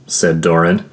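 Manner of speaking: ordinary delivery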